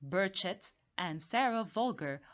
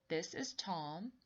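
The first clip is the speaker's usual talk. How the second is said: ordinary talk, nothing marked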